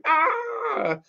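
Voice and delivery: a squeaky mock yell of anger; angry puppet cry. Mickey the puppet showing anger, high squeak.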